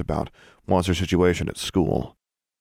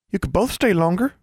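hopeful, pitched up high; anxious hope; 13 semitones higher than usual. A narrator's reading. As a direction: ask it with eager hope. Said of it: "forbearance said hopefully".

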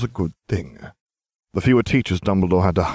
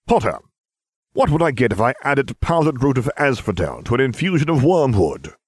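stern professor demanding; a hint of cold stern teacher; Snape demanding; 6 semitones higher than usual. A narrator's reading. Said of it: "Severus Snape demanded".

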